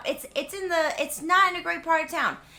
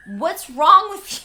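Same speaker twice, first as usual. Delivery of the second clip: gruff scolding; 5 semitones higher, 12 dB louder, slower than usual. Retold or imitated. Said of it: "Mister Magushnik is like".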